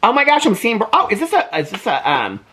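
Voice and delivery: high, gushing squeals of delight, slowing on the gasps; real thrill spilling over at each picture; squealing over owls and woodpeckers in a gift book; excited squeal. Real, not acted. Spotting birds in book.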